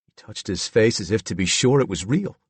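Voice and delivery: ordinary delivery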